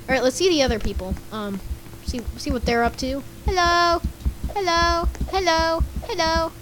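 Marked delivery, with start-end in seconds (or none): 3.5-6.5 high, evenly held repeated hellos; calling hello. Pretend. Player greeting the other people in the game, high repeated calls. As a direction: call out in a higher voice, over and over.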